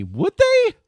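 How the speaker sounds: mock doubt. Mock doubtful question to chat.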